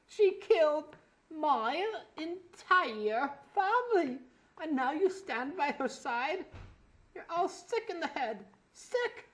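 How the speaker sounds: high, loud, slow bad British accent; overacted grieving British villain; hammed-up British villain. Demon Bash rants at the ponies in a bad British accent.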